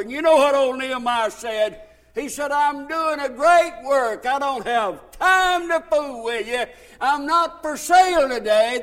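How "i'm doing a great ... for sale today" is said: loud, higher, emphatic refusal; ringing pulpit defiance; defiant preached reply. Acted. Voices Nehemiah's defiant reply in sermon.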